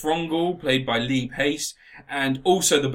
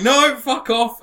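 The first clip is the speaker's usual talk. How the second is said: loud, high comic rebuff; hammed-up shouted refusal; mock angry refusal; 8 semitones higher, 6 dB louder, slower than usual. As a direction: throw the demand back loudly. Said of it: "they're like".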